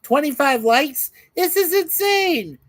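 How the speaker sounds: high, loud disbelief; amazed. Real, not acted. Host amazed at his stream's like count, disbelieving. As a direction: sound stunned by the number.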